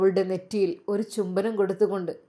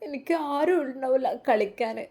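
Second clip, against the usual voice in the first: put-on sobbing little girl; 5 semitones higher than usual. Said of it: "ഐഷുവിൻ്റെ തേങ്ങൽ".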